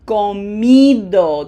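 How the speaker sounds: comido with MI loud and stretched; slow, loud comido, leaning on the I; stress on the I. Shown as a demonstration. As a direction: punch the middle syllable: co-MI-do. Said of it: "the I gets it".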